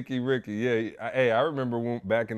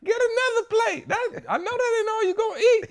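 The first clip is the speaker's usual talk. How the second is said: high, put-on voice of a relative pressing more food; pushy relative; 4 dB louder than usual. Relatives at the family event pushing seconds, high mock voice. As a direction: squeal it like an insistent auntie, laughing.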